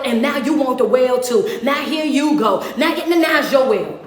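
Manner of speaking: loud, driving sermon cadence, pressing each line; preaching at full force. Acted. Preacher rebuking the copycats, loud and driving. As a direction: hammer it home to the congregation.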